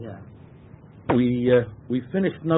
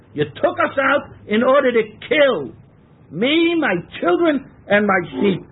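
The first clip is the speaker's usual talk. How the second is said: higher, rising into an outraged accusation; indignant complaint; 7 semitones higher, 3 dB louder than usual. Acted. Teacher voices Israelites accusing Moshe, outraged and higher.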